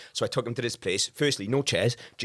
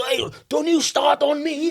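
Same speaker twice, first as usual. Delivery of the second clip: mock hard man; 11 semitones higher, 3 dB louder than usual. Voicing a pub troublemaker.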